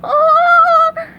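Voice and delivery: slow, very high question into scream; piercing cartoon horror; horrified shriek. A narrator's reading. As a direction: shriek in disbelief, then scream. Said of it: "shrieked SpongeBob".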